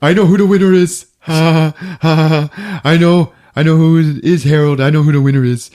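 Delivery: hammed-up giddy outburst; mock excited. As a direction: blurt it out gleefully. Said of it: "John Doe's like".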